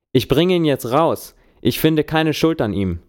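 yelling at a raging mob; shouting over the noise. A narrator's reading. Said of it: "schreie ich gegen den Lärm".

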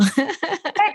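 bright high laugh. Real, not acted. Host laughs at girls' breathing.

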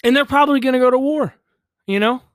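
loud, fast, heated rant; heated outburst. Real, not acted. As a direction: push it out fast and loud in frustration. Heated rant about war.

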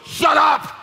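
high, loud bellowed shout; angry yell. Retold or imitated. Owner opening the door to yell at the barking dog. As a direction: bark the order out the door.

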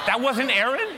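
loud, high, incredulous echo; shocked disbelief. Retold or imitated. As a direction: throw the news back as a stunned question. Father repeats news in disbelief.

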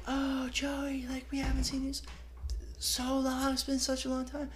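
high, breathy-free falsetto of gushing parents. Retold or imitated. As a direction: squeal it like delighted old family friends. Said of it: "and they're like".

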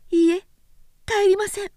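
put-on high, loud young woman's no; firm refusal. A narrator's reading. Oyuki refusing Sōshichi, high and loud. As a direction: plant her feet and refuse. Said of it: "強く".

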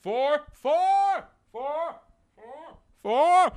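high, loud golf warning call; shouting four. Retold or imitated. Golfers screaming a warning across the crowded course, loud and high. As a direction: yell it across the fairway. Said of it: "Everyone was going".

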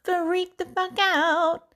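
slow, high mock freak-out. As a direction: stretch it out high like you're about to snap. Retold fury, comic.

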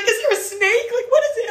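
high, loud panicked questions; mock alarm. Group asking the screamer about a snake, mock alarm.

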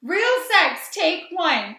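excited shout. Real, not acted. Excited recognition.

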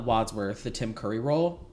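normal talking voice

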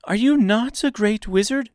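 high, loud disbelieving question; astonished girl. A narrator's reading. Girl questioning the supposed wizard, high astonished voice. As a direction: ask loudly and high in astonishment.

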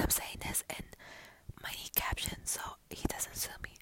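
hushed, secretive whisper. Pretend. To listeners, hiding from [name], a whisper. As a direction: murmur it so nobody overhears.

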